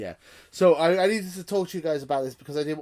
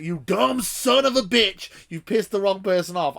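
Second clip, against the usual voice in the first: higher, louder, sneering mock-rage at the viewer; mocking angry shout; 7 semitones higher, 7 dB louder than usual. Retelling the organiser's rude reply, mock furious shout. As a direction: spit it out like an outraged jerk.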